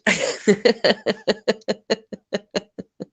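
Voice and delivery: long, high staccato laughing fit; real drawn-out giggling fit. Real, not acted. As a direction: laugh on and on in short pulses. Amused by the shadow remark.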